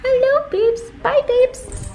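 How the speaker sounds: cutesy voice. Pretend. Saying goodbye to the car in a cutesy voice. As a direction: coo it in a sweet high voice.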